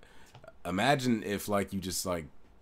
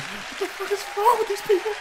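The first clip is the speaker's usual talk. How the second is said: much higher, mocking a whiny kid; 5 dB louder than usual. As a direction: squeak it out like a fed-up child. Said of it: "He sounds like a kid".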